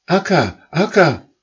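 high, loud calls across the sky; calling out. Acted. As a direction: call after the leader goose from far behind. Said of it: "riefen dann die".

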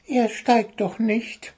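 high, slow bird voice; high slow little bird; tattling bird. Acted. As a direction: start to blab. Bird blurts out secret.

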